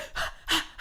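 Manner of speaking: sharp unvoiced panicked gasp; hyperventilating. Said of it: "They're going from".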